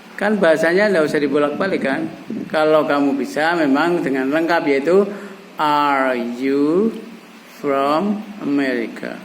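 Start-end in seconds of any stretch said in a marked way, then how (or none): 5.6-9.2 very slow, drawn-out question; slow teaching example, dropping at the end; slow model phrase. Shown as a demonstration. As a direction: spell the question out word by word. Models the full question slowly.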